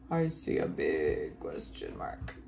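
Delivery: exaggerated low, rumbling big voice; very deep voice. Teacher spotting punctuation with children, very deep voice. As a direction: drop right down as low as possible. Playful repeat in two voices.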